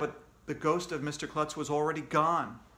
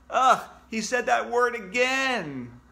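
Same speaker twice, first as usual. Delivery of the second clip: disgusted groan; 8 semitones higher, 6 dB louder, slower than usual. Acted. Kid disgusted at a word.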